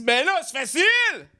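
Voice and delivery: high, slow put-on voice, ending in a laughing shout; crude relative. Comedian imitating his vulgar mother giving advice, high mock voice. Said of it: "quelqu'un de super vulgaire dans mon entourage qui me dit".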